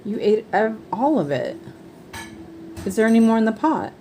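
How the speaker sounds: high, loud, disbelieving questions; surprised questioning. Real, not acted. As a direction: ask it in surprise, quickly. Mother questions child about eating.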